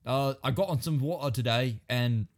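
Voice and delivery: put-on English small talk; English accent. Shown as a demonstration. Demonstrating his English accent in greetings, put-on. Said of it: "I can do a pretty good English accent".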